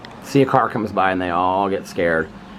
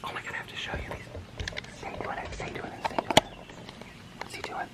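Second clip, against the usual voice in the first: hushed whisper so the chipmunk stays; whispering while filming a chipmunk; 15 dB quieter than usual. Real, not acted. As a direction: breathe the words, barely a sound. Whispering not to scare chipmunk.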